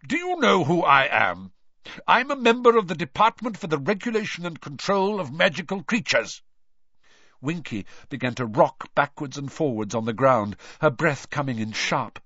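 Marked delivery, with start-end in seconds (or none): 0.1-6.4 loud stern authority. A narrator's reading. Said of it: "said Mister Diggory sternly".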